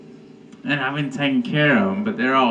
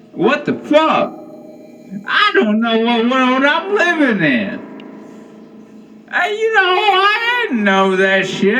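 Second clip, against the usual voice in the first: incredulous rant; 12 semitones higher than usual. Acted. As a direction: rant in disbelief, voice cracking high. Rising rant about Texas.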